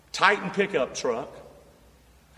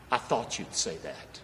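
much lower and softer, hushed; soft retold reply; 11 semitones lower, 8 dB quieter than usual. Builder answering the preacher in the truck, low and hushed. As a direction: hush it right down.

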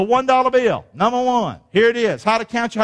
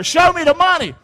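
high-pitched, punchy exclamation; catchphrase call-out; 8 semitones higher, 4 dB louder than usual. Acted. Preacher reminding himself when down, high excited call-out.

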